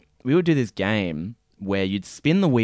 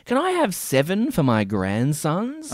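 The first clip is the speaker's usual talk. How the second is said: imitated customer; 6 semitones higher, slower than usual. Imitates pleading prize-game customers.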